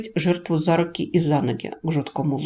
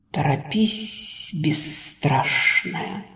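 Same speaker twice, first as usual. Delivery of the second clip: slow, otherworldly serpent voice; ancient creature voice; 2 semitones lower, much slower than usual. A narrator's reading. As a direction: speak slowly as an ancient spirit. The great Le speaks.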